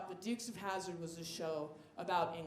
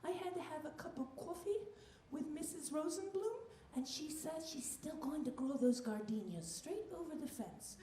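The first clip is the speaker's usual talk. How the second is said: grandma voice; 4 semitones higher, 7 dB quieter than usual. Retold or imitated. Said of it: "she'd be like".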